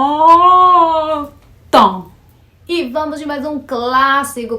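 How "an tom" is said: loud, high drawn-out An, then tom with the mouth closed; exaggerated nasal demo. Shown as a demonstration. Teacher showing the nasal vowel, loud and exaggerated. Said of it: "tipo assim, ó".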